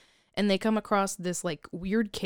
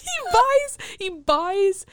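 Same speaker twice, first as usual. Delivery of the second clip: much higher, squealing through laughter; laughing squeal; 12 semitones higher, 6 dB louder, much slower than usual. Real, not acted. Friend squealing with laughter about the new boat.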